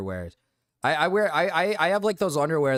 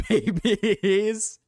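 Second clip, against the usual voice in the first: laughing; 8 semitones higher, 4 dB louder than usual. Real, not acted. Joking about baby voice cover.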